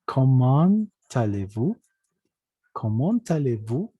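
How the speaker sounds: slow, deliberate "comment allez-vous", rising at the end. Shown as a demonstration. Teacher models it twice after the rule, slow "comment allez-vous". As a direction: stretch out "comment", sounding its T into "allez". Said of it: "la E N se vuelve A N".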